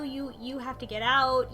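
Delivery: ordinary delivery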